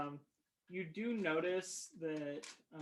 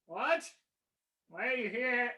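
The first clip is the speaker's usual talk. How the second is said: higher, louder squeaky little mage; a startled, nasal high voice calling from the next room; high-pitched nasal voice; 5 semitones higher, 6 dB louder than usual. Acted. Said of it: "you hear a high-pitched, nasally voice".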